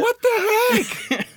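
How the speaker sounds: high, slow, stunned; shocked disbelief. Real, not acted. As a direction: stretch it out in disbelief. Shocked at tiger's kill count.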